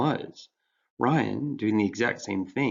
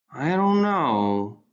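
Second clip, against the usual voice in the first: slow, pondering; 5 semitones higher, 6 dB louder, much slower than usual. Acted. Ryan thinking it over.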